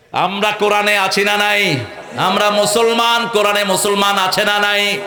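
loud rousing call to the crowd; fiery preaching. Acted. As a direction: preach it hard, demanding an answer. Preaching at full force.

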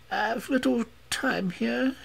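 put-on very high, foreign-accented woman's voice; foreign woman confessing. A narrator's reading. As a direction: confess it all in a sustained high voice.

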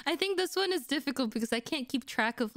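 usual speaking voice

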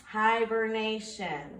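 hibernation said slowly, each syllable separate; slow syllable count. Shown as a demonstration. Teacher counting syllables for students, slow and drawn out. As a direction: draw out hibernation beat by beat. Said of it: "We'll do this one".

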